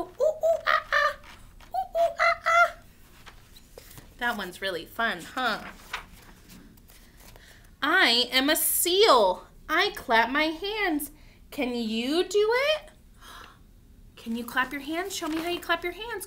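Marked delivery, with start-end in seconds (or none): 0.0-2.7 high, loud monkey hoots; monkey call. Acted. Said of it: "like a monkey and go". 7.8-12.9 slow, loud picture-book read-aloud; reading to children. Acted.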